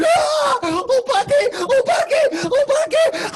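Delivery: very high, loud panicked screams; terrified screaming. Retold or imitated. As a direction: scream Obake in terror. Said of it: "I hear outside screaming".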